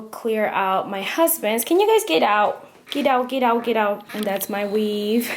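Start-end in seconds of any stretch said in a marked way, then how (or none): 1.6-4.0 loud playful shoo, higher. Real, not acted. Shooing the kids out of the bathroom, loud and playful. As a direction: chase them out with a raised voice.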